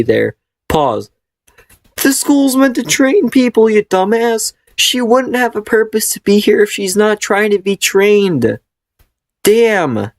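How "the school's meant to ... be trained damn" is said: high, scolding rant at a dumb character; exasperated rant. Real, not acted. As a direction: berate the character like they're an idiot. Ranting at the story character.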